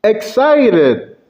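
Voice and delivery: high, excited exclamation; demonstrated burst of excitement. Shown as a demonstration. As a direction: leap up with excitement. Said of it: "range of emotions".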